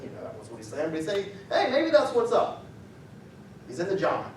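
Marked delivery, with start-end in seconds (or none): 1.5-2.6 mocking. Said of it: "He's insulting them".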